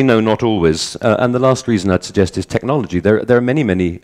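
ordinary talk, nothing marked

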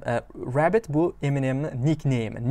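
ordinary talk

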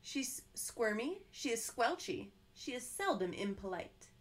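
ordinary delivery

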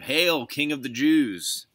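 loud, high, drawn-out hail; mock salute. A narrator's reading. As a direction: call out a slow, ringing salute. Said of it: "they began to salute him".

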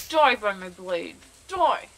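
loud hammed-up warrior shout; mock battle cry. Streamer attacking in the game, mock battle cry. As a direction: declaim it loudly like a playful swordsman.

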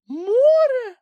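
very high, loud squeaky cry; shocked piping shout; Kulka crying out. A narrator's reading. Kulka discovers the sea instead of the pole, shrill cry. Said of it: "вигукнув Грампус".

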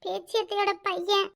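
barely dares to say his name; quiet confession. Acted. Daughter naming her cousin to her mother, very quiet.

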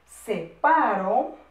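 very slow SEPARO with a rising end; slow dictation. Shown as a demonstration. Repeats the dictated word for writers.

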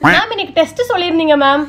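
eager tattletale. Acted. Reminding teacher of test.

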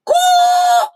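high, loud squawking caw; parrot caw. Shown as a demonstration. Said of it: "really rather not very nice voice".

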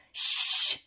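loud hushing hiss; sharp hiss. Acted. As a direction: hiss it to silence someone. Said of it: "hissed Professor McGonagall".

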